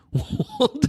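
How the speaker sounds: sudden high burst of laughter. Real, not acted. Laughs at own joke.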